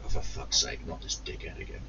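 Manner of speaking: low muttered under the breath, fed up; muttered inner groan. Retold or imitated. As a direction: grumble it to yourself, unvoiced. Inner groan at annoying customer.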